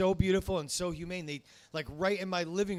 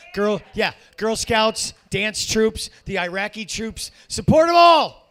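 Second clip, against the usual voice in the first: louder and higher to a full yell at the end; building comic shout; 5 semitones higher, 13 dB louder, slower than usual. Acted. Comic whipping up the crowd, escalating shout. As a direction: ramp it up into a big rally cry.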